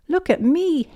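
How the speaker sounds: high, loud show-off cry; cackling child witch. A narrator's reading. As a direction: crow it high like a little witch. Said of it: "cackled Peppa".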